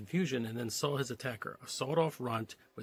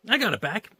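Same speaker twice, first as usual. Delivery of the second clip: louder, higher call; boy calling out the news; 9 semitones higher, 10 dB louder than usual. Acted. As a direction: shout it so she hears. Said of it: "He called".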